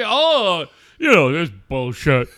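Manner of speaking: loud, mocking whine of an upset fan; mock whiny fan. Host mocking upset fans, loud put-on whine. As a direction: sneer at the complainers in a put-on voice.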